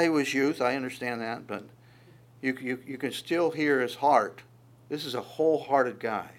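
usual speaking voice

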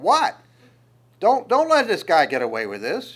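loud outraged what, pushed hard; indignant shout. Retold or imitated. Young David protesting the giant, loud and indignant. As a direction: protest loudly at the giant's insult.

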